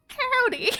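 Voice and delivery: a cocky mock Southern greeting; Southern drawl greeting, proud and overdone; southern accent. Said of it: "I can hear the confidence".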